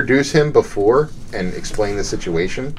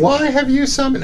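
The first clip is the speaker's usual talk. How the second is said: put-on high girly voice, pitched way up; girl voice. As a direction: squeak it out like a girl. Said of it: "Can you do a girl voice?".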